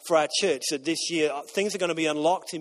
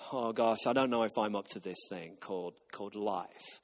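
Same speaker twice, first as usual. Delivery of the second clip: much lower and softer, doubting; unsure inner voice; 9 semitones lower, 10 dB quieter than usual. Retold or imitated. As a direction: drop low and quiet like a private worry. Voicing a self-doubting inner thought.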